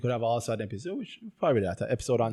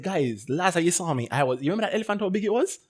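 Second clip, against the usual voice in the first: higher-pitched, animated storytelling voice; hammed-up amazement, higher than usual; acted excited boast; 6 semitones higher than usual. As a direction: play an elephant rider showing off. Voices the elephant rider boasting.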